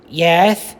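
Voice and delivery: lively storybook narration for a class; reading aloud to children. A narrator's reading. Teacher reading a picture book to her class, animated storytelling. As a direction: read it like story time for kids.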